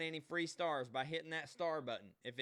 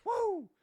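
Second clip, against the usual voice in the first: high piercing wrestler woo; sky-high Nature Boy woo; Ric Flair woo; 8 dB louder than usual. Pretend.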